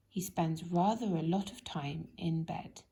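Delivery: plain reading voice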